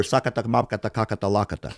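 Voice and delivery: lightly voiced Macedonian-sounding gibberish; mock Macedonian gibberish. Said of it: "people say, "Hey, can you speak Macedonian?".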